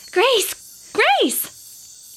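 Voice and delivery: put-on high, calling child voices; girls calling out. Young girls calling to Grace across the path. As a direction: call her name across the path like little girls.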